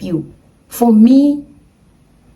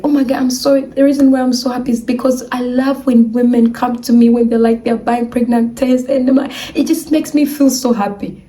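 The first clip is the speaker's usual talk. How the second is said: higher, excited retold chatter; happy gushing; 4 semitones higher than usual. Pharmacist delighted for a test buyer, happy gushing. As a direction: gush as an overjoyed shop assistant. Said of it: "The woman was happy".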